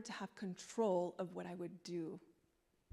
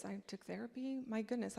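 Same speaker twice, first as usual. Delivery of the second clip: usual speaking voice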